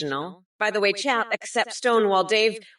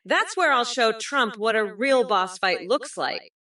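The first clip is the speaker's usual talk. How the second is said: normal talking voice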